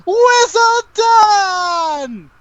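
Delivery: very high, drawn-out wizard scream; crazed high-pitched wizard cry. Acted. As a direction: scream it high and hold the last syllable. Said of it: "someone screaming".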